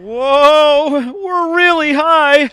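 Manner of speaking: high, loud startled cry; startled whoa. Acted. Actor on a tall elephant crying out startled. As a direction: caught off guard by the height.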